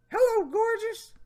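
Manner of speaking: high, put-on creepy greeting; reading his chat line in a high mock voice; Jeff Stacy voice. Reading the predator's chat, high mock voice. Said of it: "my Jeff Stacy voice".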